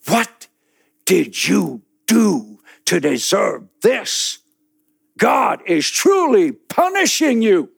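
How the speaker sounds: high, loud accusing voice. Acted. Muhammad condemning the man in the pit, loud accusation. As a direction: condemn him. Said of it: "and he says".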